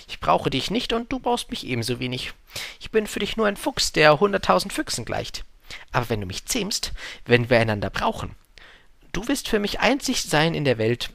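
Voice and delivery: lightly voiced earnest storybook fox; fox, earnestly explaining.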